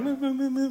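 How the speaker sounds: high-pitched mock wailing of a wife; mock crying. Husband imitates his wife crying in bed, mock wail. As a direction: imitate a wife's sobbing as a high whimper. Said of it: "She's crying".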